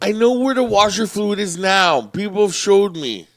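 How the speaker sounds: mock defensive. Real, not acted. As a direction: insist loudly over the teasing. Mock-defensive about being teased.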